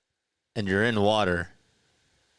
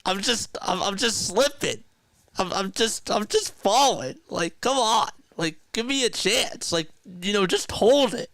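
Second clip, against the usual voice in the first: much higher, strained pleading voice; whiny younger self; 13 semitones higher than usual. Retold or imitated. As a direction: squeak out helpless excuses while slipping off. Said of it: "And I'm like".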